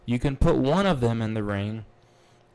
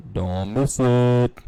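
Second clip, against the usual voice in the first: slow, louder, stretched "Don't miss it"; hype-man promo delivery; drawn-out emphatic plug; 6 dB louder, much slower than usual. Acted. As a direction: lean on it like a promo tagline.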